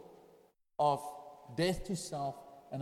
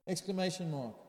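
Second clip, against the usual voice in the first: normal talking voice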